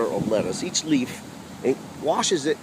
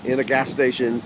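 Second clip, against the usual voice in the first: ordinary delivery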